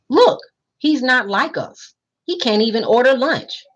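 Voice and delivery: put-on Johnny tells his friend the new boy is different, mildly sneering; mild scorn. A narrator's reading. A touch of childish scorn. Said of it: "spoke up".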